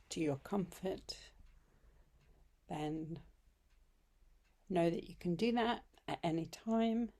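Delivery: unhurried meditation voice with long pauses; guided meditation. Acted. Yoga teacher welcoming listeners home to yoga nidra, guided meditation. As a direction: lead them gently into rest, phrase by phrase.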